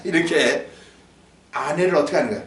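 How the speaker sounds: ordinary talk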